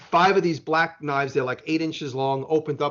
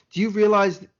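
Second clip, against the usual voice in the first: higher, louder reproachful question; mock scolding; 6 semitones higher, 5 dB louder than usual. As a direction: imitate an official telling someone off. Retells customs woman scolding him.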